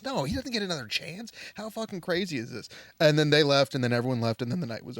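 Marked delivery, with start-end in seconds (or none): 0.0-2.7 mock indignant woman. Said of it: "She goes".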